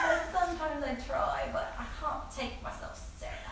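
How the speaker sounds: self-mocking upper-class British; posh British accent. Shown as a demonstration. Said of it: "but, like, the posh kind".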